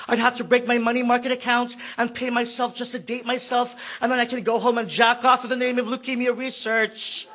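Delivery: ordinary talk, nothing marked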